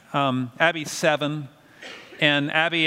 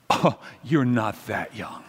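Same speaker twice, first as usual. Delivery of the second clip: lower, slow, deadpan eye-roll; an unimpressed seven-year-old; eye-rolling sass; 7 semitones lower, 3 dB quieter, slower than usual. Retold or imitated. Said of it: "she rolled her eyes".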